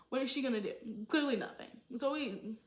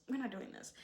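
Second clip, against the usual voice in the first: softer, dropping away to a murmur; quiet final refusal; 7 dB quieter than usual. Real, not acted. Fading off after refusing a storyline. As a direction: let the last refusal fade out.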